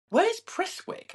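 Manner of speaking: very high, drawn-out mock bafflement; baffled. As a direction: squeak it out slowly, acting out your disbelief. Acts out own baffled reaction.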